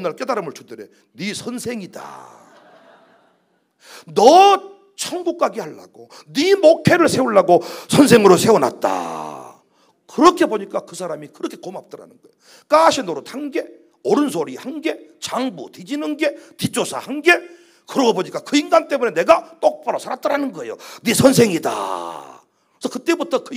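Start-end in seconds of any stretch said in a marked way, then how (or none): 1.1-9.6 slow, solemn, booming divine voice; voice of God. Acted. 기도하는 목사에게 하나님이 깨우쳐 주는 장면, slow booming solemn voice. God's answer voiced in prayer story. 21.0-22.4 low, slow and loud divine pronouncement; voice of God. Acted. 하나님이 목사에게 다시 말하는 장면, low slow booming voice. God's words repeated.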